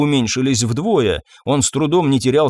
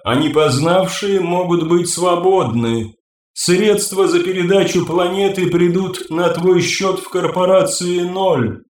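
ringing crystal chime voice; 4 semitones higher than usual. Acted. The Sella figure continues.

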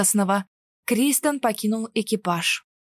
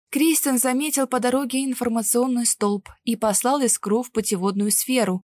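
ordinary delivery

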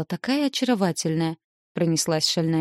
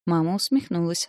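usual speaking voice

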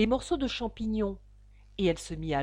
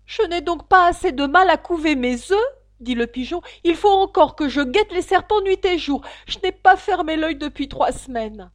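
plaintive pigeon; 7 semitones higher, 9 dB louder than usual. Acted. Pigeon complaining past Alice, high plaintive voice. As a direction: list each failure with mounting despair.

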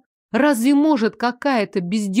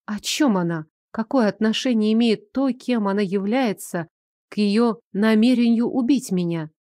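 ordinary delivery, nothing marked

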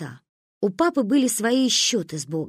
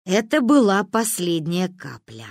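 usual speaking voice

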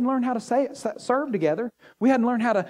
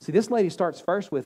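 ordinary talk

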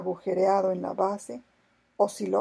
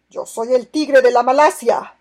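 proud loud proclamation; 5 semitones higher, 14 dB louder than usual. A narrator's reading. Pirate reveals his identity.

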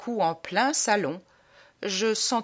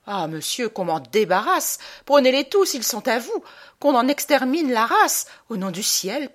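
raised, louder theatrical begging; exasperated plea; 3 semitones higher, 4 dB louder than usual. Acted. Man begs a buyer to take the dogs, exasperated plea. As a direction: beg frantically to be rid of them.

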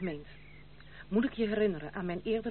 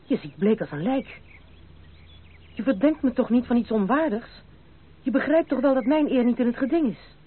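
anxious urging; 2 semitones higher, 8 dB louder than usual. Acted. Francis anxiously defends her honour.

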